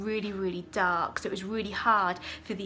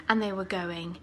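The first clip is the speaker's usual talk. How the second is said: ordinary delivery, nothing marked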